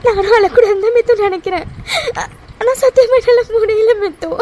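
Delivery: sobbing words out to a friend; crying to her friend about Arjun. Acted. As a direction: let the tears break each phrase. Holds crying throughout.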